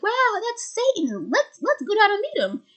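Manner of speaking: high, singsong "wow" of awestruck fans; excited followers. Retold or imitated. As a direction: imitate eager, wide-eyed followers. Retelling excited followers' reaction.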